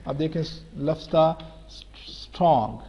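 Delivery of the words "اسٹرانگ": اسٹرانگ with the o opened to aa; low-pitched demo of open-vowel اسٹرانگ; o moved toward aa. Shown as a demonstration. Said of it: "او کی pronunciation کو".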